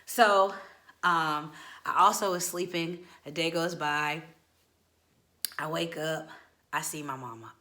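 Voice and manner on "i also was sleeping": ordinary delivery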